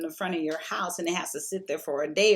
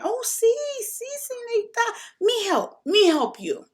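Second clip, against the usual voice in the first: imitating a Mexican man's broken English, much higher voice; broken English Mexican accent; 4 dB louder than usual. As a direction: put on the Mexican guy's accent, high and eager. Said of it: "he's talking to me in his broken English".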